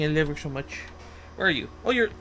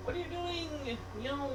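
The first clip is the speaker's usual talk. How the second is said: tiny high voice, much softer; cooing at a pet; 8 semitones higher, 15 dB quieter than usual. Real, not acted. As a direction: coo it quietly to the cat. Cooing at the cat.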